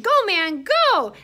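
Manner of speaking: loud, high cheer; excited shout. A narrator's reading. Cockroach waiters urge on the fly, excited shouting. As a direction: cheer the drummer on at full voice. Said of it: "The cockroach waiters shouted".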